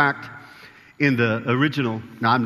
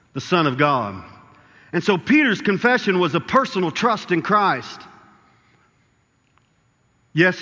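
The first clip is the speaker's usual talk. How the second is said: rising sermon preaching; 3 semitones higher than usual. Acted. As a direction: preach it with growing conviction, punching the key words. Preaching builds to full force.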